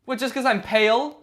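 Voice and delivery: loud, high, offended questioning; indignant protest. Acted. Zombie objecting to being called a zombie, indignant. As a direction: push back hard at the accusation.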